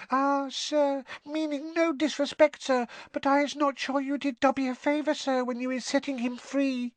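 deferential, high-pitched elf voice; respectfully disagree in a tiny squeak; squeaky house elf. A narrator's reading. Winky doubts Harry did Dobby good, squeaky elf. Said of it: "a teeny quivering squeak of a voice".

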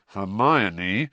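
words pushed through clenched teeth; tense, teeth clenched, barely holding back; through gritted teeth. A narrator's reading. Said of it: "Harry said through gritted teeth".

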